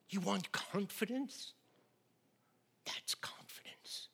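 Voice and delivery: slow question falling into a whisper; hushed challenge. Real, not acted. Preacher hushes the congregation, slow and whispered. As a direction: ask it slowly, then whisper the answer.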